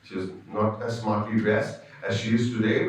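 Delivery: normal talking voice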